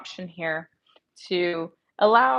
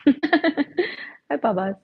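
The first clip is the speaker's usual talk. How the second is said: cooing to dog; 7 semitones higher, much slower than usual. Real, not acted. Greets her dog.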